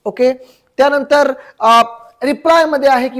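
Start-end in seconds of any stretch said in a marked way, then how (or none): none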